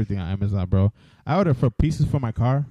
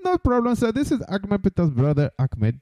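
much higher mock Indian call-centre agent; mock call-centre accent; 9 semitones higher than usual. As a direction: hang up sweetly in the mock agent voice.

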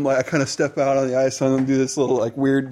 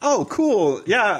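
high voice; 6 semitones higher, much slower than usual. Retold or imitated. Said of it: "I'm like".

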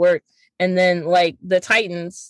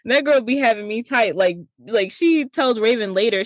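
normal talking voice

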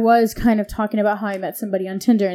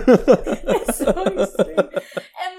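laughing; 4 semitones higher, much slower than usual. Real, not acted. Laughing reaction.

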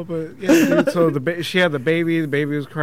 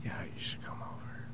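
unvoiced whisper, very soft; whispered invitation; 8 semitones lower, 16 dB quieter than usual. Ex on the phone, whispering an invitation to come over. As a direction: breathe it like a secret call.

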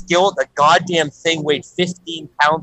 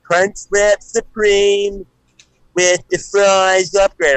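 very slow, over-spelled "Crunchwrap Supreme", each word dragged out and pitched up; speaking to an idiot; 4 semitones higher, much slower than usual. Retold or imitated. Repeating the order slowly to a confused Taco Bell cashier. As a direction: spell it out slowly as if to someone who can't understand. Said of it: "speak to her like a fucking idiot".

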